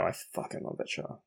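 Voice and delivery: a hushed, devoted confession; real whisper. Real, not acted. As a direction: whisper it, gushing under the breath. Gushing about a favourite show.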